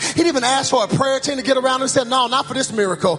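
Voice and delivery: full-force preaching. Real, not acted. Preacher calling the congregation to get up, full-force preaching. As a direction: build relentlessly toward a rallying cry.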